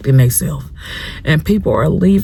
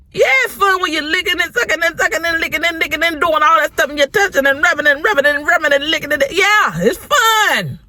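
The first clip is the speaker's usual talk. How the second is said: mock gushing; 8 semitones higher than usual. Mocking teen pleasures in lecture.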